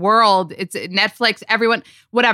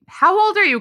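surprised; 11 semitones higher, faster than usual. Real, not acted. Shocked by her age.

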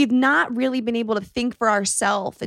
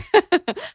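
short laugh; 10 semitones higher than usual. Real, not acted. Laughing about mom fist pumping.

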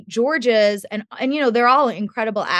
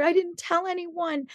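mock tearful child, pitched high; imitated tearful plea; 10 semitones higher, 4 dB quieter than usual. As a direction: act out a boy's plea in a small, high voice. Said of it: "his cheeks are wet with these tears".

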